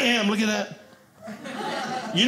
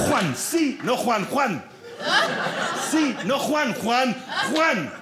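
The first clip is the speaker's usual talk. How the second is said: higher mock Spanish game shouts; 6 semitones higher, slower than usual. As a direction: ham up a Spanish card game. Spanish Uno game players.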